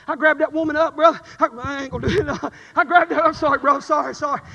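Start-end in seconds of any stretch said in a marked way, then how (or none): none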